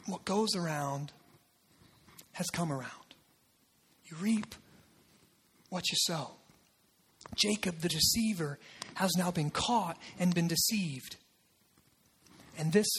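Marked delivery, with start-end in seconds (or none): none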